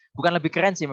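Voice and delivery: usual speaking voice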